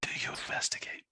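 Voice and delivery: hushed whisper. Acted. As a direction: whisper the question. GM suddenly whispers.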